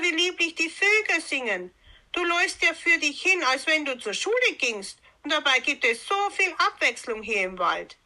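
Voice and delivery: coaxing, high-pitched sly persuasion; full of cunning. A narrator's reading. Wolf tempting Rotkäppchen off the path, full of cunning. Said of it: "sagte er auf einmal voller List".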